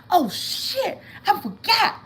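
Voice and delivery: high, sudden cry of dismay; retold dismay. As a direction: yelp it when you realize too late. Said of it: "I said".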